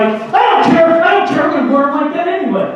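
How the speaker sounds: high, loud mock teen protest; hammed-up adolescent defiance; defensive teenager. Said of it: "teenagers get real defensive".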